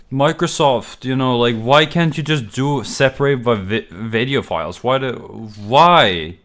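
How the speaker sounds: loud exasperated complaint at the computer; frustrated rant. Real, not acted. Streamer ranting loudly at Microsoft, exasperated. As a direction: vent loudly at the useless system.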